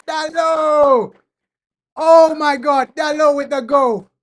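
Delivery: ecstatic high screaming; shrieking jackpot winner. Real, not acted. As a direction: shriek the name in pure joy. Goal scored, fan erupts.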